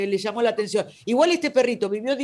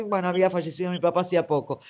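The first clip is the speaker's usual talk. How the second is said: ordinary talk, nothing marked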